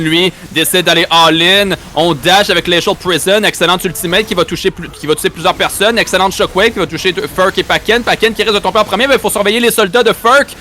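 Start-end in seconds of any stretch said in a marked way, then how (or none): 6.3-10.6 rising, fast excited casting; excited play-by-play. Acted. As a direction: build the hype as the fight unfolds. Live casting of a teamfight.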